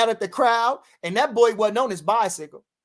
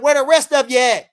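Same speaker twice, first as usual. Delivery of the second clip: shouted comeback, pitch up; loud retort; 5 semitones higher, 9 dB louder than usual. Retold or imitated. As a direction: fire it back loud at him. Retorting loudly to a fan.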